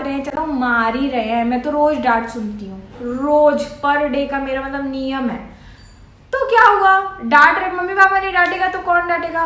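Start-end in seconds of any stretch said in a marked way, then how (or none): 3.0-3.7 rose alone, louder, falling; stress on rose. Real, not acted. Stressing rose, telling viewers she is scolded daily. 6.3-9.4 higher and louder, pushing back; so what, fired up; emphatic. Real, not acted. Loud, urging viewers to accept scolding from parents.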